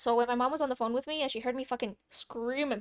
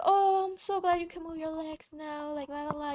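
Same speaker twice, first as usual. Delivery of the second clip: sweet lady; 5 semitones higher than usual. Retold or imitated. Kind stranger in the ER.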